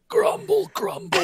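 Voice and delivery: grumbling as if just exhumed; groggy, half-dead mock grumble; dug up from the grave. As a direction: mumble like a roused corpse. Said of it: "pulled him out of six foot under".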